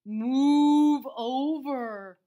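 loud, very slow bossy cow order; demanding cow. A narrator's reading. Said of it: "ordered Bossy Cow".

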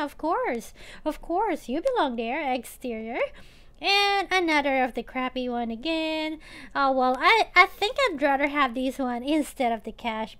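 usual speaking voice

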